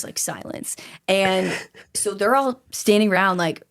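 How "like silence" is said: dropped to a whisper for effect; hushed. Acted. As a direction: whisper it, build suspense. Dramatic hush in story.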